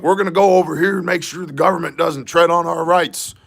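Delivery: imitating militia members boasting about defending their rights; mocking militia guy. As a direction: ham up a self-important militia member.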